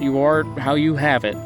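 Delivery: ordinary delivery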